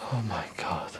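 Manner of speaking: low breathless gasp of words; whispered in dawning dread; horrified whisper. Acted. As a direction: let the horror drain your voice. Father realising, horrified.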